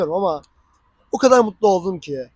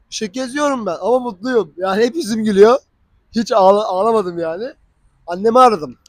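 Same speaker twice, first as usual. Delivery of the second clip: normal talking voice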